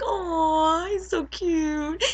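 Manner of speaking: high, slow, drawn-out "aw"; adoring coo. Retold or imitated. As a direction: coo as if at a puppy. Cooing over scared hero.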